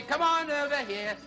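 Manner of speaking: ordinary delivery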